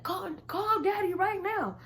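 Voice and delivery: high, loud, slow order; loud demand. Retold or imitated. Brother ordering her to call their father, high and loud. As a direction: command it.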